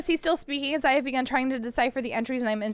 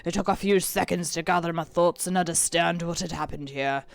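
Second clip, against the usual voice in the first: put-on pirate accent; 6 semitones lower, 3 dB louder than usual. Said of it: "I need a pirate voice".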